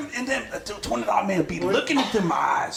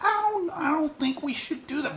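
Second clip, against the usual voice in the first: higher-pitched, hesitant woman's voice; imitated reluctant girlfriend; 9 semitones higher than usual. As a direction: do her gently putting him off. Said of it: "And she like".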